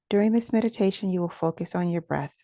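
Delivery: ordinary delivery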